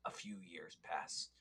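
dramatic hushed whisper narration; old sci-fi announcer. Pretend. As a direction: whisper it like a trailer voice. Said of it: "a fun voice".

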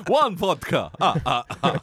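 mock Russian accent through laughter; laughing Russian impression. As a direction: giggle through a cartoon Russian. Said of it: "that was our impressions of Slava".

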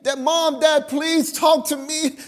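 put-on high, begging child voice; child begging parents to talk to them, high and pleading. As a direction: plead like a neglected kid. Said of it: "plead and beg".

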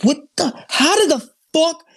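can't believe what you just heard; retelling his stunned reaction to a friend; stunned disbelief. Retold or imitated. Retelling his shock at the news.

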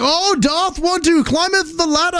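high, put-on olde English voice; imitating a fair actor's lofty speech, pitched well up; mock Renaissance fair character. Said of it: "he's full character".

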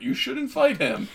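high-pitched mock pleading; acted Ashley quote. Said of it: "Ashley just being like".

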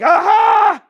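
high, drawn-out mock aha; exclaimed discovery. Teacher acts out a student's highlight, mock excited discovery. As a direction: hold it on a sustained high note.

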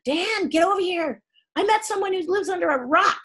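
mock yell. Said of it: "So Bill yells for Dan".

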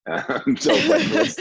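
words squeezed out through a burst of high laughter; cracking up mid-sentence, barely able to go on; laughing. Real, not acted. Interviewer cracks up at his Kenyan passport joke, laughing through the words.

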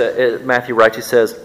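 usual speaking voice

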